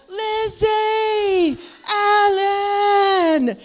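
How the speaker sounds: long, high, loud sing-song shout; shouting while running through the crowd; yelling a name. Retold or imitated. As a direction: belt her name over the band.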